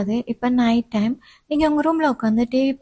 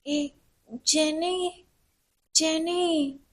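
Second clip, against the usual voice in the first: soft, higher call that fails to carry; feeble call; 4 semitones higher, 6 dB quieter, much slower than usual. Acted. Student calling feebly for her friend Jeni. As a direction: meant to shout, comes out small. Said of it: "ரொம்ப slow வாய்ஸ்ல கூப்பிட்டல".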